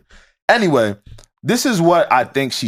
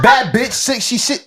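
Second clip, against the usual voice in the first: higher, twitchy addict rap voice; crackhead voice; 7 semitones higher, slower than usual. Shown as a demonstration. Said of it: "I'm trying to read and crackhead, uh, tone is hard".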